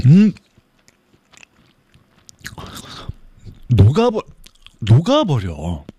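talking while chewing; mukbang. Real, not acted. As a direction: mouth full, whispering. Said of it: "소리 들려요?".

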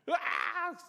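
high-pitched bawling cry; acted "waaah" of a wronged little brother; mock crying wail. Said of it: "우는데".

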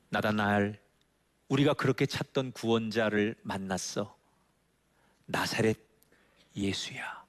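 ordinary delivery

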